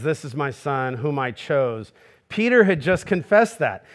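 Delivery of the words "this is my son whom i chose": solemn divine pronouncement; voice of God. Acted. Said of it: "what God says is".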